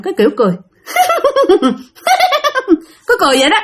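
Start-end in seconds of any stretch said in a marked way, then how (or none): 0.9-1.8 high, self-satisfied snicker; strange teasing chuckle. Retold or imitated. Ghost imitating a man laughs after teasing someone, strange satisfied chuckle. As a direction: chuckle as if a prank just worked. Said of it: "cái tiếng ổng cười kỳ lắm".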